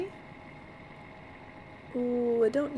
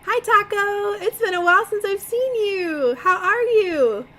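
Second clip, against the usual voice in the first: cooing at pet; 11 semitones higher, 6 dB louder than usual. Real, not acted. Welcoming her pet Taco back to the stream, high and cooing.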